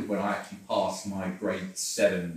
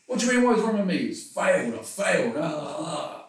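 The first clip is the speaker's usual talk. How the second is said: high, loud frustrated rant, mock-hammed; mock angry outburst; 8 semitones higher, 6 dB louder than usual. Retelling his upset reply to his wife, hammed-up frustration. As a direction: whine it like a sulking kid who failed.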